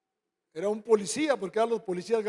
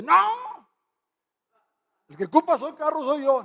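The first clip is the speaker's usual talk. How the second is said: high, loud yelp of protest; protesting disciple; 5 semitones higher, 6 dB louder than usual. Retold or imitated. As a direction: cry out the refusal fast. (protesta aguda y fuerte)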